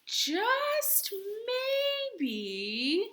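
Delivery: drawn out, high just then maybe; suspenseful storybook pause; dramatic pause. A narrator's reading. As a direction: tease the idea slowly.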